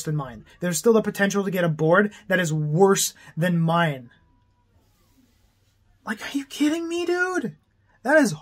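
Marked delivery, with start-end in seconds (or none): 6.1-8.3 high, stunned disbelief; are you kidding me, pitched way up; incredulous. Real, not acted. Ranting vlogger to viewers, high incredulous disbelief.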